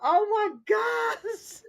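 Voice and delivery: high, slow, tearful oh my gosh; crying with laughter. Real, not acted. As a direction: gasp it out while laughing to tears, real. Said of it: "I'm crying".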